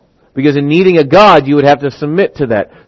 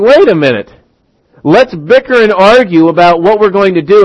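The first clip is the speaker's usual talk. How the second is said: raised-pitch imitation of family bickering; mock objection; 5 semitones higher, 4 dB louder than usual. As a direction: cut in with a mock protest. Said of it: "And you're going".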